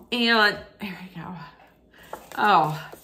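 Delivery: breathless whisper as tears come; choked whisper. Real, not acted. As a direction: barely get the words out, whispering. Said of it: "they're coming down again".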